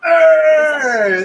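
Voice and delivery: high, loud excited hey; a boy greeting his uncle with a loud hey; excited kid shout. Acted. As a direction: burst out with joy. Kid exclaims loudly.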